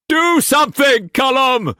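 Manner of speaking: high, loud shout; shouting against the wind. A narrator's reading. Cullum shouting at himself over the storm, loud. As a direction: yell over a gale. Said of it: "he shouted against the wind".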